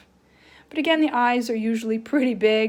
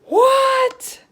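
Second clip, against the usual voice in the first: surprised gasp; 11 semitones higher, 5 dB louder than usual. Shown as a demonstration. Teacher showing kids a surprised face, loud gasp. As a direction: gasp then a high loud what. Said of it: "your mouth is usually going like this".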